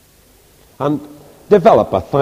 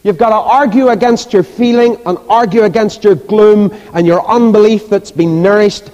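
high, forceful exhortation; preaching; 8 semitones higher, 5 dB louder than usual. Real, not acted. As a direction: urge the congregation on, voice raised.

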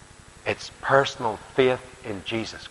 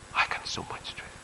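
awed whisper; 10 dB quieter than usual. Real, not acted. Preacher to his congregation, an awed whisper. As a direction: breathe the wonder of it.

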